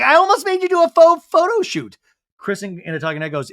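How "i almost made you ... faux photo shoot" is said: high, loud retold outburst; animated mock retort. Retelling Zach's crack about a photo shoot, animated mock retort. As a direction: pitch it way up and louder, hamming up the quote.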